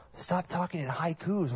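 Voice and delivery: hushed aside to mom; whisper. Retold or imitated. Son telling mom to stop, whispered. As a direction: breathe it out dry and exasperated.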